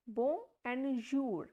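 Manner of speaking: "bon" and "jour" said apart and slowly, "bon" nasal; slow "bon and jour" for learners; slow syllable split. Shown as a demonstration. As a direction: break it into "bon", then "jour". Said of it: "at the place of N".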